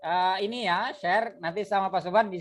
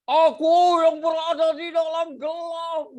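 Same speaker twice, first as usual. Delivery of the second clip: shouted much higher and louder, slow; wrong shouted reading; 9 semitones higher, 6 dB louder than usual. Shown as a demonstration. Teacher showing students the wrong, shouted way to read, loud and high. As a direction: bellow the poem line as a bad example. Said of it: "Boleh nggak bahasa gini?".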